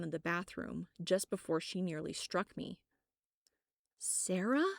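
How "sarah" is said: surprised call. Acted. Narrator calls out to coworker.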